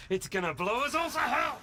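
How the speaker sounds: yelled high and loud in anger; furious shout at a careless partner; angry shout. Acted. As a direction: blow up at him.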